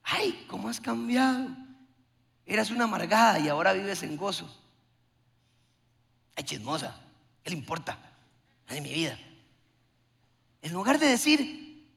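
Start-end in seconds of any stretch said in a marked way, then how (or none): 0.0-9.2 sarcastic gossip voice, lower and softer; a hint of a nosy churchgoer sneering; mocking gossip. Preacher imitating a gossip who mocks a changed woman, softer and lower.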